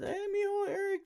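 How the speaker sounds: squeaky high grandma calling her grandson; grandma's greeting. Retold or imitated. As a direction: chirp it up high like a sweet little grandma.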